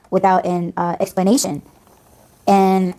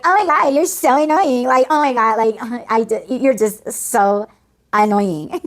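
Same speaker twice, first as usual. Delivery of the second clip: higher-pitched, hammed-up annoyance; exasperated mocking; 5 semitones higher, slower than usual. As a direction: whine it in exasperation, drawing out SO. Acted exasperation at the gushing girls.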